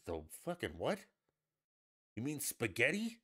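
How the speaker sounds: incredulous comeback, pitch climbing at the end; mock disbelief, questioning; baffled reaction. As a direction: act baffled by her pronunciation. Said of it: "It's like".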